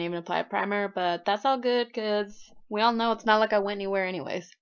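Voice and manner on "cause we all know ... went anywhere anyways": normal talking voice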